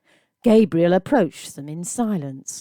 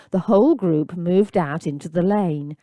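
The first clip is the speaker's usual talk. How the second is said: usual speaking voice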